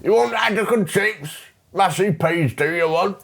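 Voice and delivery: high, garbled British chip-shop man; incomprehensible British accent. Acted. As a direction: overdo the British accent until it's barely understandable. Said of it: "can't understand a thing that this man is saying".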